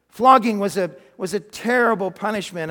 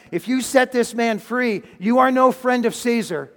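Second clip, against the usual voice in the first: loud, raised warning; leaders shouting; 5 semitones higher, 6 dB louder than usual. Acted. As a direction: shout it as a threat to the governor. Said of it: "religious leaders started shouting".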